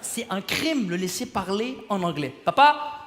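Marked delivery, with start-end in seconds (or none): 2.4-3.0 calling dad. Acted. Child calling father in a story.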